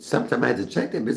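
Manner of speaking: usual speaking voice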